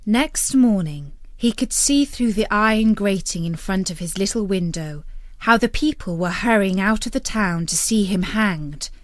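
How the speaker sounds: usual speaking voice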